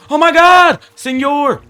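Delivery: high, loud cry of alarm; panicked scream. Real, not acted. Player to escaping fish Senor, panicked scream. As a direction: shriek after an escaping pet.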